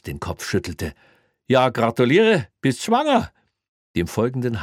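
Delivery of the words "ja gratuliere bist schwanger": high, loud teasing jab; mocking. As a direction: sneer a mock congratulation. Cop mocking a colleague.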